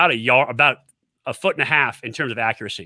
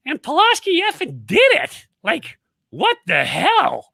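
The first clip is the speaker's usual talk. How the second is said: much higher, squeaky Maryland coach voice; squeaky excited coach; 13 semitones higher, slower than usual. Retold or imitated. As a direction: play the excited head coach in a high squeak. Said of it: "high squeaky voice when he gets excited".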